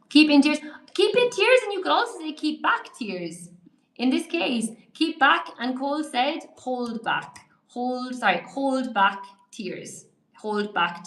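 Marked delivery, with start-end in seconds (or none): none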